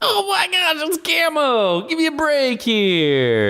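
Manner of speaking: high, slow exasperated whine. Real, not acted. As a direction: plead give me a break, fed up. Arguing over unfair proxy points.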